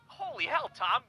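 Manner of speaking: normal talking voice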